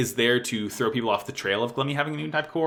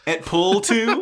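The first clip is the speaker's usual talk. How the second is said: higher, slow, hammed-up Shakespearean "Et Pull Two?" with a rising end; mock Brutus; 8 semitones higher, 4 dB louder, slower than usual. Punning on Brutus's dying words to the co-host, hammed-up and rising. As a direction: play it as a betrayed Roman, tongue in cheek. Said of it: "I love when Brutus says that".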